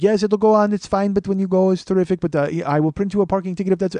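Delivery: put-on high Indian-accented clerk. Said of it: "he's got that, uh, accent".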